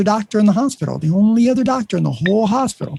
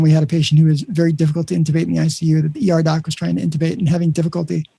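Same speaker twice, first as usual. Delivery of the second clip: ordinary talk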